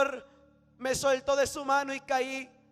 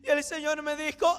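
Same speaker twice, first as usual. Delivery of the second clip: ordinary talk, nothing marked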